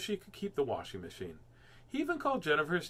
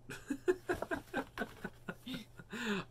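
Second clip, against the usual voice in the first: high delighted laugh; 10 semitones higher, 9 dB quieter than usual. Real, not acted. Laughing at hidden note.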